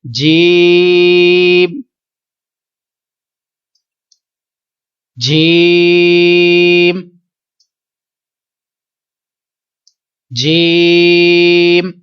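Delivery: the right जीम, stretched out clean; जीम drawn out without going into the nose; correct way. Shown as a demonstration. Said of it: "आप मेरे साथ repeat कीजिए".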